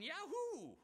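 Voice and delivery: high, loud preacher's cheer; joyful whoop. Retold or imitated. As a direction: whoop with delight at the revival. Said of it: "Any other preacher would be going".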